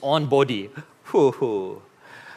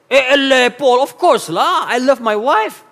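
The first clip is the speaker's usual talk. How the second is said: put-on high, slow Malaysian English accent with lah; playful Malaysian accent, higher and drawn out; mock Malaysian accent; 9 semitones higher, 3 dB louder than usual. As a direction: ham up a Malaysian husband's protest. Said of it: "how come do I sound with that accent".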